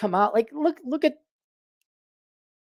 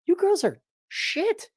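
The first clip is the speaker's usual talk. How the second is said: mock scolding girl. Principled girls scolding the others.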